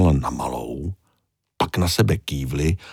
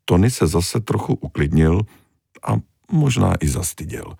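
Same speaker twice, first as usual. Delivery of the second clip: ordinary delivery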